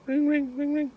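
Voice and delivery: high-pitched voiced telephone ring; phone ringing imitation. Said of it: "the phone rings".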